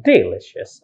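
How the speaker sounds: "delicious" with DE punched at the front; wrong first-syllable stress. Shown as a demonstration. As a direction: misplace the stress: DE-licious in "delicious". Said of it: "Not the first".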